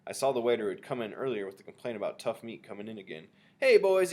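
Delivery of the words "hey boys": loud, higher hail; shouted. A narrator's reading. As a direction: hail your workmates across the room. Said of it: "he shouted".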